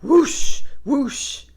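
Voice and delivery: high airy whoosh, loud; whoosh sound. Acted. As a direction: blow it out like a gust of wind. Sheep shaking horns, wind noise.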